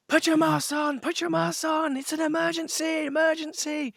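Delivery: shrill alarm as she runs the aisle; frantic stewardess. Retold or imitated. Stewardess running down aisle ordering masks on, frantic high cry. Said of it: "stewardess came running down the aisle".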